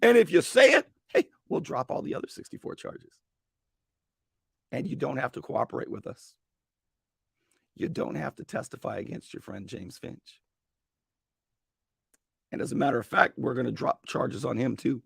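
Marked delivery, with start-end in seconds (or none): 1.1-10.4 whispered, conspiratorial deal; hushed offer. Retold or imitated. Prosecutors quietly tempting her with a deal, hushed. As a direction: lean in and tempt her softly.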